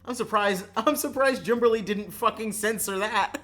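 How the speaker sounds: loud, high, breaking into laughter; amused disbelief. Real, not acted. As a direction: exclaim it on the edge of cracking up. Real amused outburst, then laughing.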